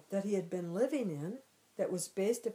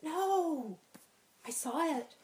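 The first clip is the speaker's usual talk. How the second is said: frantic denial; 9 semitones higher, 4 dB louder, slower than usual. Retold or imitated. Panicked boy insists on snake.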